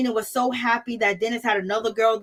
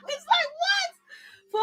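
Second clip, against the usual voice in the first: high squealing laugh into a slow "what?"; laughing disbelief; much slower than usual. Real, not acted. Host laughing at Porsha's excuse, high and incredulous. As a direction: laugh it off in shock.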